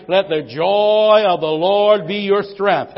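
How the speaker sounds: each word slow and loud; slow loud proclamation. Real, not acted. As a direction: proclaim it slowly to the church. Preaching at full force.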